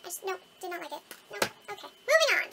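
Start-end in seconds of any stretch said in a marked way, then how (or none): 2.0-2.5 high, loud moving on; loud exclaim. Real, not acted. Announcing to viewers, loud and high. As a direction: call it out brightly.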